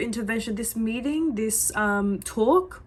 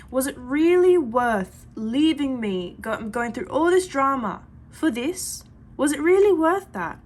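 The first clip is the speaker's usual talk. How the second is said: doubting; 4 semitones higher, 5 dB louder, slower than usual. Pretend. Imagined doubting thought, client's voice.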